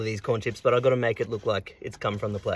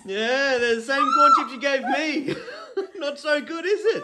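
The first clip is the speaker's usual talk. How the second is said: gloating teasing; 13 semitones higher than usual. Real, not acted. Gloating at her pain.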